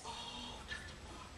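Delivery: a breathy, hushed, overly dramatic sigh of a name. Retold or imitated. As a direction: sigh it out like a little girl being theatrical. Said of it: "She's so dramatic".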